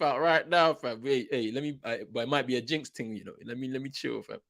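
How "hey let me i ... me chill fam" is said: ordinary talk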